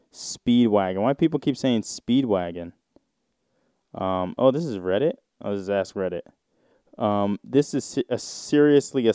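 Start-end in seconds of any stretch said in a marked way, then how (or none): none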